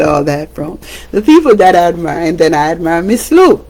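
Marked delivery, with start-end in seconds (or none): none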